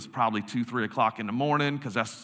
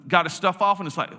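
ordinary delivery

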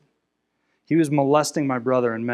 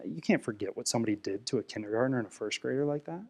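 ordinary talk, nothing marked

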